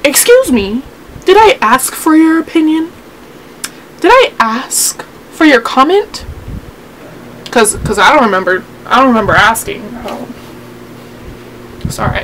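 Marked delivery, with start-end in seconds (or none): 0.0-6.2 sassy offence with swooping pitch; indignant comeback. Pretend. Snapping back at people who correct her talk, indignant. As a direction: challenge the critic, leaning hard on key words. Answers imagined critics of her talking.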